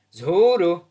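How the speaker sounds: loud chorus of "Vzhůru!"; kids shouting. Acted. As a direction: shout it all together. Class shouts together.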